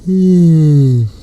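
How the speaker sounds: loud, drawn-out real yawn; big yawn. Real, not acted. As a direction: let out a long, tired yawn. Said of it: "But I'm up here yawning".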